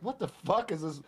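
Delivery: high mock-baffled outburst; imagined bewildered reaction. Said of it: "he's like".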